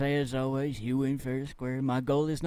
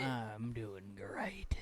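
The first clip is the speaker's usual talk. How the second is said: slow, breathless whisper of a wreck; weak whisper; 3 semitones lower, 9 dB quieter, much slower than usual. Pretend. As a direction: croak it out like you're barely alive. Replies to "you look like shit.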